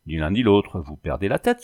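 plain reading voice

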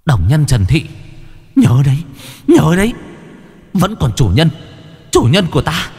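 evil spirit screaming revenge as it is destroyed; shrieking spirit curse. A narrator's reading. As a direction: howl a vengeful curse as you're banished. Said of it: "Nó gào rú lên".

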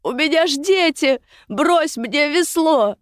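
high, drawn-out cry for help; desperate plea. A narrator's reading. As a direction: beg for your life from the water. Drowning woman pleads for help.

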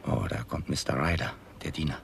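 ordinary delivery

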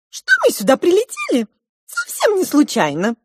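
very high, squeaky little voice; piping little mouse. A narrator's reading. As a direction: pipe it up like a small animal. (тонкий писклявый голосок)

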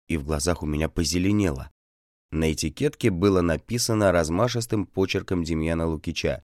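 ordinary delivery

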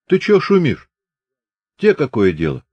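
lightly voiced Chubaty, sharp high start; Chubaty's voice. A narrator's reading. Chubaty snapping back at Grigory, lightly voiced. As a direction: brush him off coldly.

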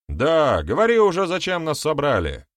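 high, loud impatient chiming in; egging on. A narrator's reading. An elder backs up the heckler, impatiently egging on. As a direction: join the heckling, demanding an answer. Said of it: "поддакнул один из старейшин".

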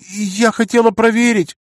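put-on high, indignant young woman; defensive girl voice.

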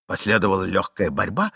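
usual speaking voice

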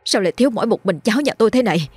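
put-on high, fast and frantic, a parent missing a child; panicked cry. Parents crying to villagers about lost child, panicked and fast. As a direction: blurt it out in rising panic. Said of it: "hốt hoảng la lên".

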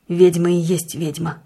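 a muffled, lightly tearful voice. A narrator's reading. Husband accuses his wife, muffled and tearful. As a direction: say it half-crying, the sound muffled. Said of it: "глухим плачущим голосом".

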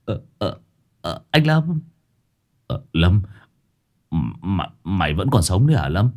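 hesitant, stammering disbelief; dazed bewilderment. A narrator's reading. Addict friend seeing Lâm alive, stammering in bewilderment. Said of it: "rồi ngơ ngác".

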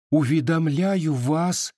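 high, slow start of reading a letter; girl reading aloud. A narrator's reading. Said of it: "начала Дуняшка". (высоким голосом, медленно начинает читать)

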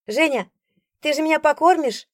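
put-on high, loud begging boy; pleading child. A narrator's reading. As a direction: plead with big eyes.

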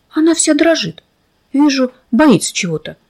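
plain reading voice

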